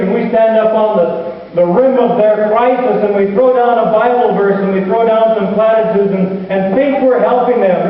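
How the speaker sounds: full-force preaching. Acted. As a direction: preach it hard, mocking from up high.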